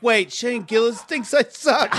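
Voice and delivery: high, slow disbelief; retold dismay. Comedian learning who insulted him, retold dismayed disbelief. Retells own dismayed reaction.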